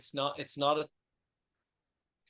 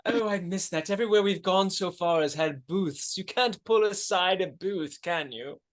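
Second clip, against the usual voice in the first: high, fussy upper-class gent; posh gentleman voice; 4 semitones higher, 9 dB louder than usual. Acted. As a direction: play the anxious posh date. Patrick role continues.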